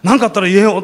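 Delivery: protective, big-brotherly push; fired-up cop. Retold or imitated. Policeman handing over his card, imitated eager. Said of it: "お巡りさんの方が興奮してる".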